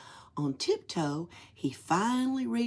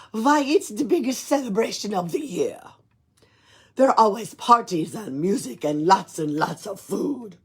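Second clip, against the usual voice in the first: put-on louder Russian-accented Nutcracker; 3 semitones higher, 8 dB louder than usual. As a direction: proudly describe the feast in a Russian accent. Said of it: "he spoke in a Russian accent".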